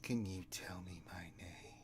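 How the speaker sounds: soft teasing ghost whisper; whispering spirit. Acted. Spirit teasing the master, whispered. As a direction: coax him like a ghost. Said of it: "ghostly figure tap her lips".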